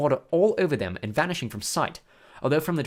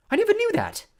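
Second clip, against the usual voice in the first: high-pitched, louder surprise; surprised; 5 dB louder than usual. A narrator's reading. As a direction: react with surprise, voice jumping up. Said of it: "looking at Ron in surprise".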